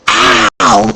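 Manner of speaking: loud hammed-up yelp of pain; mock ow. Cosplayer sitting up after fake death, loud mock ow. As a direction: play the fallen duellist.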